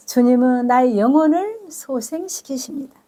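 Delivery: normal talking voice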